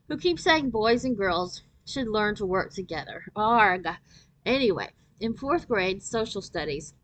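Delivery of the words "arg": usual speaking voice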